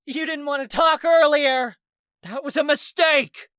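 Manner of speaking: high, loud furious yell. A narrator's reading. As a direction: yell it back in anger. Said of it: "as she yelled".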